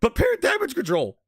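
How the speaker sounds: mock Phil Spencer barking orders in a squeaky voice. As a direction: squeal like a panicking boss shouting orders. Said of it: "His little squeaky voice".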